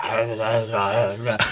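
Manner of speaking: low, vacant uh uh grunts; lightly voiced stupor; dazed grunting. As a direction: grunt blankly like your mind is gone. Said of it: "he's just like".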